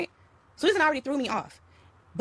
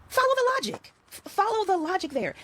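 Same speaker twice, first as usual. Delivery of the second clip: emphatic urging; 8 semitones higher than usual. Real, not acted. Urging listeners, rising emphasis.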